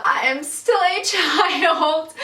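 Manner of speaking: high, slow, laughing through the words; laughing disbelief. Retold or imitated. Rejecting flirt, laughing disbelief.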